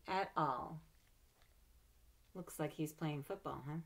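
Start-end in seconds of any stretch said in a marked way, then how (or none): none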